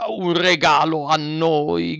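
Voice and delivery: slow, mock-offended exclamation; indignant fox. A narrator's reading. Fox feigns offense at Pinocchio's gift, slow and indignant. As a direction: sound hammed-up affronted. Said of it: "gridò la volpe sdegnandosi".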